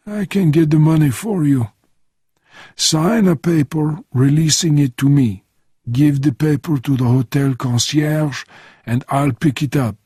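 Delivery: put-on obliging old Frenchman giving instructions.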